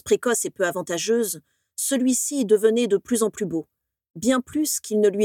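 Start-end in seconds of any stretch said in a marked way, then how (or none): none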